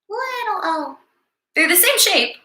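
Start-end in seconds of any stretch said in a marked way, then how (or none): 0.1-1.0 squeaky high voice for the little letter; tiny high little O. Shown as a demonstration. Teacher to kids, a tiny high voice for little O. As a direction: make it sound small and high. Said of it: "a little teeny tiny O".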